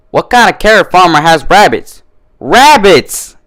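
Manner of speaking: mock outrage at the rabbits; indignant outburst. A narrator's reading.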